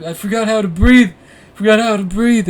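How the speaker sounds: high, loud mock panic; imitated panicked crying out. Storyteller imitating his friend writhing on the ground, panicked cries. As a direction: wail it like a hammed-up stoner freak-out. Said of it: "crying out".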